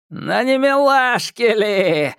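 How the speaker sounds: high, slow sneering taunt; mocking jeer. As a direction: tease them like a crazed onlooker. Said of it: "ответили ему". (насмешливо)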